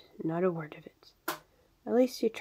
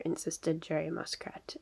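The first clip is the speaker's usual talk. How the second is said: ordinary delivery, nothing marked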